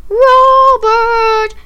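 high, loud, long-held call; shouted summons. Acted. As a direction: shout the name across a noisy hall. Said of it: "shouted over all the students".

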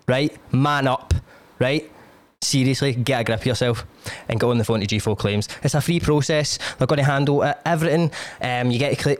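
a chatty sponsor read in his own voice; podcast ad read. Acted. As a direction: sell the sponsor like a cheeky mate. Host reads sponsor ads.

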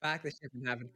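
Boston accent with dropped R's. Shown as a demonstration. Actor attempting the requested line, Boston accent. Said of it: "some very Boston".

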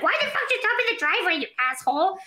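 high, fast mock-angry mom; mimicking mom yelling. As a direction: squawk like an annoyed mother. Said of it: "she's like".

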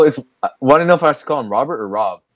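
normal talking voice

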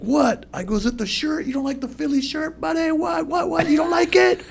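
put-on high, squeaky drunk taunting; high-pitched drunk fan taunting the beer guy; mock indignant heckle. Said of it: "And I'm like".

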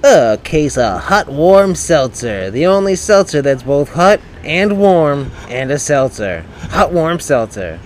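loud game show prize announcer; hard sell ad. Acted. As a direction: boom out the prize like TV. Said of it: "Tell him what he's won, Mike".

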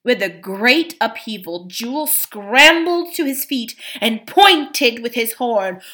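usual speaking voice

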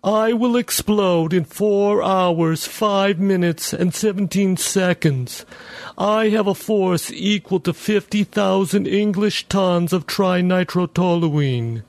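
high, slow machine voice; measured, robotic countdown; talking bomb. Acted.

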